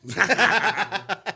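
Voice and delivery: slow, laughing try; laughing attempt. Shown as a demonstration. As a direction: stretch chaparrito out through laughter. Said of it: "trying the word, laughing".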